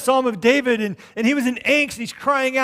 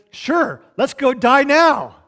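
loud, higher sarcastic disciple, stretched out; mock enthusiasm; 4 semitones higher, 7 dB louder, much slower than usual. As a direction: play the disciple loudly agreeing to doom. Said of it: "In fact, it was so funny".

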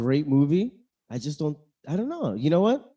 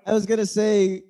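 laughing; 6 semitones higher than usual. Real, not acted. Laughing at correction.

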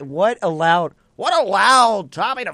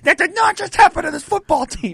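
high, loud stunned call; shocked broadcaster call; 12 semitones higher, 6 dB louder than usual. Retold or imitated. Announcer-like disbelief call.

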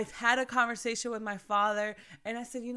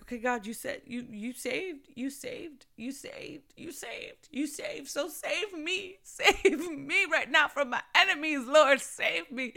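rising higher, building into a laughing plea; a mock-desperate prayer that breaks into laughter; pleading prayer; 5 semitones higher, slower than usual. Retells her pleading prayer.

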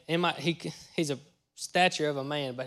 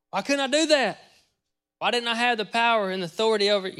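retold frustrated question; 7 semitones higher, 5 dB louder than usual. Retells himself asking Trey, frustrated.

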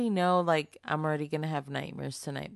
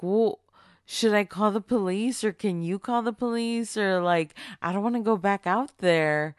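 higher and louder, pleading for help; panicked plea; 5 semitones higher, 7 dB louder than usual. Pretend. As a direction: beg as if scared to go outside. Begging cashier to call police.

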